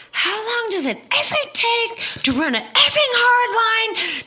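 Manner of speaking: exasperated high squeal, drawn out slowly; mock meltdown into the talkback mic; losing it. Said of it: "me losing my mind in the talkback mic".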